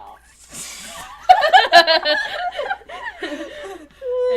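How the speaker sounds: high laughing fit; loud laugh. Real, not acted. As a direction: laugh long and hard.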